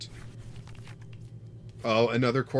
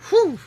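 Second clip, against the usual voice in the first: whistle of relief; 11 dB louder than usual. Real, not acted. Relief after drawing energy.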